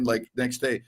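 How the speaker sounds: ordinary delivery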